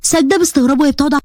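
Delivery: ordinary delivery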